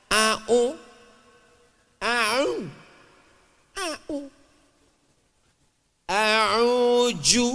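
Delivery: A'u drawn out syllable by syllable; drilling Arabic syllables. Shown as a demonstration. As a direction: sound out "A'u" carefully for a learner. Demonstrates Quran recitation syllable by syllable. (melafalkan "A'u" pelan untuk murid mengaji)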